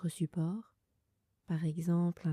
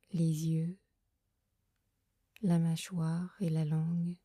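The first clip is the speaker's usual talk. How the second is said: guided meditation. Acted. Leads a Yoga Nidra meditation. (voix douce et lente de méditation guidée)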